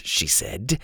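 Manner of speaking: ordinary delivery, nothing marked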